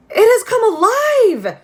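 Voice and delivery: high, loud terrified gasp; a frightened exclamation. Acted. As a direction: cry out in shock. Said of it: "I heard him gasp".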